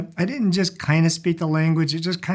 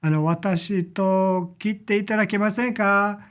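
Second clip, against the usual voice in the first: polite Japanese request; 5 semitones higher than usual. Shown as a demonstration. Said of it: "You can say".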